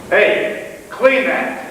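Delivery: loud, high-pitched command; barked order. Acted. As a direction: shout an order at a newcomer. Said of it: "has been told".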